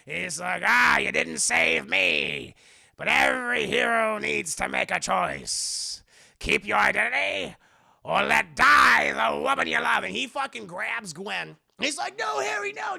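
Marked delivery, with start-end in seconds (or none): 0.7-10.0 loud, slow villain ultimatum; Willem Dafoe. Acted. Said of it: "the Dafoe voice because I like it better". 12.2-13.0 high, loud plea; mock panic. Peter pleading as Gwen is grabbed.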